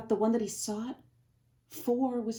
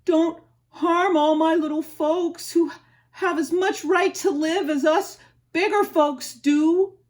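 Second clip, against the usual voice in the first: louder, higher pleading elephant; begging; 7 semitones higher, 10 dB louder than usual. Acted. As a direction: beg desperately for the little folks. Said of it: "and begged".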